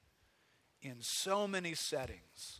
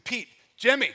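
calling out; 7 semitones higher, 12 dB louder than usual. Pretend. Calling friends over in story.

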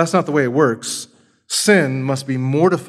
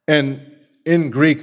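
usual speaking voice

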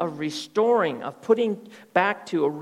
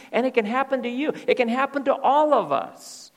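rising emphatic; 7 semitones higher, 4 dB louder than usual. Real, not acted. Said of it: "climax of repetition".

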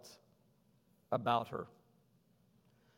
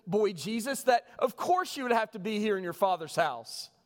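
much higher and louder, eagerly agreeing; Mary's imagined reply; 9 semitones higher, 6 dB louder than usual. Retold or imitated. Mary answering boy Jesus, high eager agreement. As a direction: gush it like an over-eager mother caught out.

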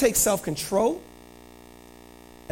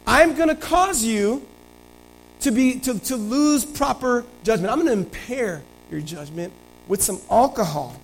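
higher-pitched scheming king; scheming David; 6 semitones higher than usual. Acted. David plotting to get Uriah drunk, lightly sly. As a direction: lay out the plan with sly intent. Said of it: "David's like".

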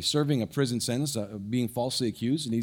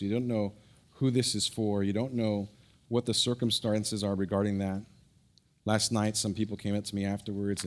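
soft, lower prayerful voice; 4 semitones lower, 3 dB quieter than usual. Real, not acted. Pastor praying over the congregation, soft. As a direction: pray it gently and sincerely.

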